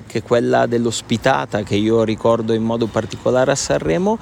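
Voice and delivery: ordinary talk, nothing marked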